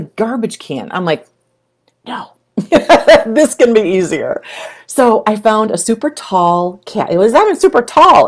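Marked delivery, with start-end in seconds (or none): 2.1-4.4 amused laugh breaking into the thought. Retold or imitated. Telling viewers her own thought, laughing. Inner voice, excited realization. 7.1-8.3 quick, louder burst of excitement; excited. Real, not acted. Excitedly correcting herself to her viewers.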